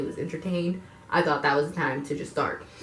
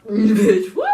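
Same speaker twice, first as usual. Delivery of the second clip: loud, high "Bitch, what?" breaking into a laugh; mock shocked yell; 10 dB louder than usual. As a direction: yell it in disbelief and crack up. Acting out her shocked reaction.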